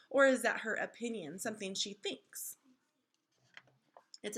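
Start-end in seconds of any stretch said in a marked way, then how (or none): none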